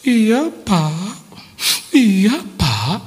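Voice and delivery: high, swooping reply with a rising lilt; possessed woman replying. Acted. Possessed Wati answers the pastor, high put-on voice.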